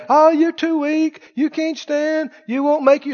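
a hint of a high sneering devil; devil taunting. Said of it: "The devil will tell you".

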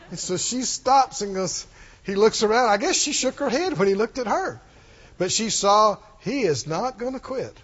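ordinary talk, nothing marked

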